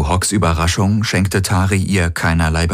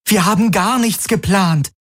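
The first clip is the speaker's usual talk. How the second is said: hoher, empörter Ausruf; aufgebrachtes Rufen. A narrator's reading. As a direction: die Anschuldigung hoch herausrufen. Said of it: "rief Kirai". (empört rufend)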